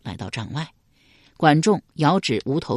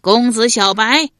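louder, higher mocking call; taunting shout; 5 semitones higher, 6 dB louder than usual. A narrator's reading. Soldier calling out the duke's name, loud taunting shout. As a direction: yell the name to provoke. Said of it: "那一人复又喊道".

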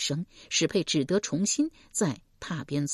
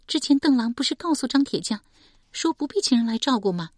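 put-on higher, grieving young woman's voice; young woman recounting her mother's murder, higher voice; young woman Shi Pei; 8 semitones higher, 3 dB louder, faster than usual. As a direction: tell it as a wounded daughter.

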